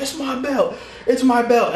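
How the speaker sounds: high-pitched mock yell; high, hammed-up victory cry; retold excited yelling. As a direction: re-enact crowing in victory, voice jumping high. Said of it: "and I'm just yelling".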